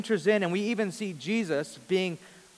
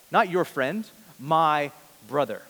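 normal talking voice

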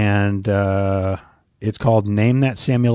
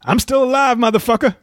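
high, tough movie-gangster voice; Samuel L. Jackson impression; 12 semitones higher than usual. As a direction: growl it like a badass gangster. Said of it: "I can't do his voice great".

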